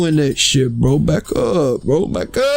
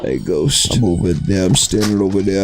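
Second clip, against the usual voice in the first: much lower, timid pretend fright; mock scared voice; 7 semitones lower than usual. Talking to his friend Ghost, mock scared and low. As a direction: play it small and scared, dropped low.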